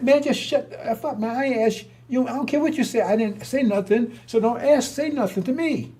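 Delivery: retold angry telling-off. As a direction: tell him off hard. Retold confrontation, telling him off.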